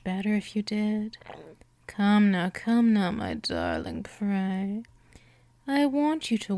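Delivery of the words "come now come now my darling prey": normal talking voice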